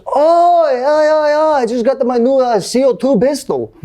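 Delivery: high, hammed-up Swedish accent; cheerful singsong mock-Swedish. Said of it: "that's the best Swedish accent I got".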